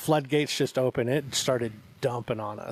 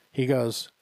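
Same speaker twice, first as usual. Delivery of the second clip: usual speaking voice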